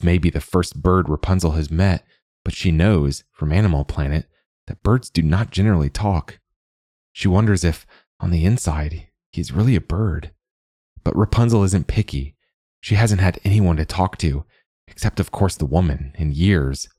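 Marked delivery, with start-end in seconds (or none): none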